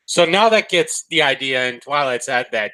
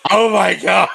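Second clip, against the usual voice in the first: real delighted outburst; 4 semitones higher than usual. Real, not acted. Cohost hearing the absurd book office name, laughing shout. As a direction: burst out in disbelieving laughter.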